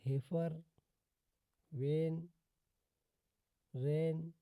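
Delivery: word-by-word demo with a held "ay"; teacher's spaced examples, long ए in वेन and रेन; "ay" vowel. Shown as a demonstration. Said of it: "उच्चारण है ए से करते हैं".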